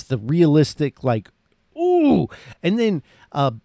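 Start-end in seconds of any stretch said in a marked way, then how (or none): 1.8-2.3 high pained ooh, as if seeing the wound; a sharp high wince of disgust; wincing ooh. Retold or imitated. Wincing at gruesome movie kill.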